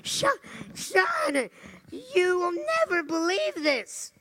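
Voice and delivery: high, loud, breathless excitement; frantic caller. Retold or imitated. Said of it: "this woman is absolutely frantic".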